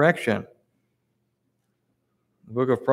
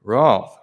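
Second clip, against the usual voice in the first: "wroth" punched louder with a hard fall; hammered word; 5 dB louder than usual. Shown as a demonstration. Preacher driving home that God can be wroth, punched loud. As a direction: drop "wroth" heavily at the end. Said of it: "God can be".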